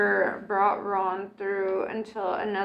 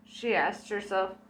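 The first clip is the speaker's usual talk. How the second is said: ordinary delivery, nothing marked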